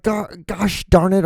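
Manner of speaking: high, slow pretend exasperation; mock mad. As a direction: ham up a squeaky-clean curse. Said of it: "they use fudge".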